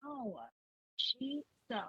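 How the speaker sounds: muffled, faint mic audio; into a pillow. Real, not acted. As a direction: sound like talking into a pillow. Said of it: "It's like you're talking into a pillow".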